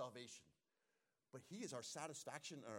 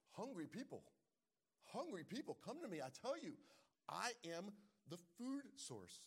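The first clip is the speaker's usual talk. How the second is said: building call; 7 semitones higher than usual. Acted. Pastor voicing Jesus calling hungry people, rising preached call. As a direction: call out to them, rising each time.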